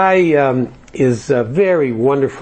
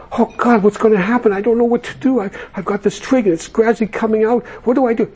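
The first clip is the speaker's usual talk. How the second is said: much higher, rushed desperate cry; panicked plea; 8 semitones higher than usual. Acted. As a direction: beg for help while slipping off the twig. Man hanging from twig panics.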